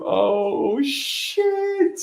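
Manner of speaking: high, drawn-out "Oh, shit"; mock shock. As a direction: gasp it like caught off guard. Imagining the nickname.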